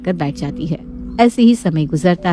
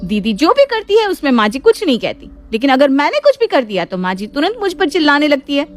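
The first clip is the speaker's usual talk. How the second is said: aggrieved complaint; 6 semitones higher than usual. Acted. Aggrieved sister-in-law complains to Nandini.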